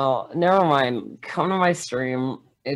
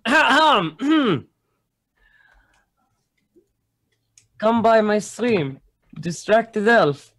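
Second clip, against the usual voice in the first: put-on Russian accent; 8 semitones higher than usual. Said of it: "do you wanna practice your Russian accent".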